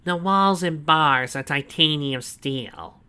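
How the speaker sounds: loud, high, slow gloating; proud villain boast. A narrator's reading. Snively proudly showing Donovan the cell, loud and slow. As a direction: savour every word of the boast. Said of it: "he told Donovan proudly".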